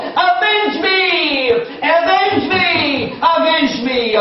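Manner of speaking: slow, high, drawn-out pleading cry; widow's plea. Retold or imitated. Widow crying at the judge's window, slow high plea. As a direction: wail it up at his window, slowly.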